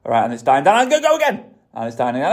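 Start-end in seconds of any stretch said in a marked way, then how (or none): 0.8-1.5 much higher, pushy command; drill sergeant. Retold or imitated. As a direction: drive them on like a drill instructor. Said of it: "You're gonna sound like a drill sergeant".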